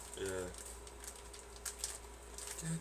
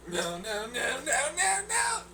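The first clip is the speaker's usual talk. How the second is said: mock despair at the noodles; panicked no; slower than usual. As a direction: pitch climbing to a crying wail. Panicked protest.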